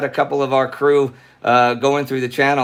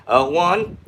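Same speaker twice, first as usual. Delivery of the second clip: normal talking voice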